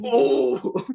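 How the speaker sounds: a high, loud mock "Oh!" of pain; winded gasp. Jesse after being thrown on the floor, winded cry. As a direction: imitate getting the wind knocked out.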